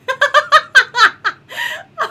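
high burst of laughter; cracking up out loud; real laugh. Real, not acted. Laughing at game chaos.

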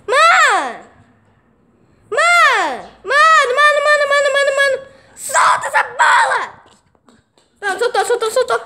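loud frantic yelling at a friend. Real, not acted. Gamer yelling at his buddy to drop the ball, loud. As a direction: shout mano over and over, rising.

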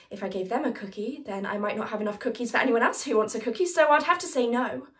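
fast and loud, building to an emphatic finish; rapid, insistent self-defence; hurried justification. Acted. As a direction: rattle out the excuse, landing hard on the refusal. Comic monologue justifying not sharing cookies.

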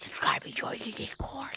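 soft unvoiced whisper. Real, not acted. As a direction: whisper the plug like a secret. Whispered channel plug aside.

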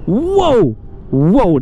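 startled whoa. Real, not acted. Scooter nearly loses control.

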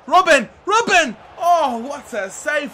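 real excited shout at the screen; excited yelling. Real, not acted. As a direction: call the player's name as the chance builds. Calling out a striker's chance.

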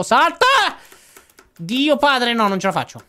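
high, loud panicked yell; panicked shout. Real, not acted. Panicking during a jump.

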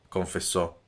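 ordinary delivery, nothing marked

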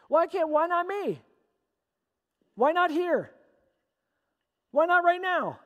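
high, insistent eager questions; excited pleading. Acted. Eunuch begs to be baptized right away, excited. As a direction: push each question up high, impatiently eager.

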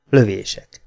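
plain reading voice

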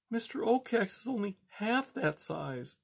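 high, soft murmur of awe; murmured in wonder. A narrator's reading. Poppy stares at the huge owl, murmuring in awe. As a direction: breathe it out in wonder. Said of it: "Poppy murmured".

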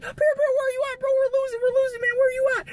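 very high, frantic squeal; panicked gamer friends. Retold or imitated. Friends on the headset losing the game, mock frantic high-pitched calling. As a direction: imitate teammates urgently calling for help.

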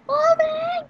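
loud, very high call; over-the-top high, loud peak; high-pitched callout. Real, not acted. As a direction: shoot the voice way up high. Third, peak of escalating calls.

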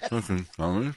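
words mumbled round food; mouth full. Real, not acted. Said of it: "you got a mouthful of food".

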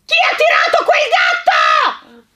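high, loud enraged bellow, slow on the question; outraged master of the house yelling at the sky; furious shout. Acted. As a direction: roar it from the dark garden. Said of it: "il grido pieno di rabbia di un uomo".

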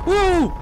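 high, loud startled whoop; sharp yelp of fright; startled yell. Real, not acted. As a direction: cry out at a sudden scare. Startled by the game.

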